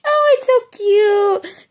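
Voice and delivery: high, slow squeal over cute kitties; slow high coo; slow gushing coo. Retold or imitated. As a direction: drag it out adoringly. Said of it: "I went from".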